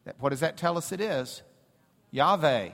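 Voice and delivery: ordinary delivery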